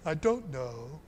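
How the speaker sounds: low, lightly voiced doubtful mumble; a hint of a puzzled disciple; Thomas unsure. Thomas answering Jesus, lightly voiced low doubt.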